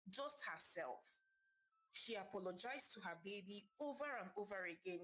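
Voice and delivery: ordinary delivery, nothing marked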